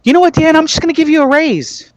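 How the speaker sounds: squeaky high imitation of the boss; high mock boss voice. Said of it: "She's like".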